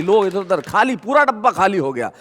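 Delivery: normal talking voice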